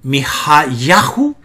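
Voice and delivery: Mijayahu drawn out slowly, accent on the penultimate ya; slow teacher's demonstration of Mijayahu, stressing ya. Shown as a demonstration. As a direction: show Mijayahu in slow syllables, weight on YA. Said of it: "aparece solamente dos veces como".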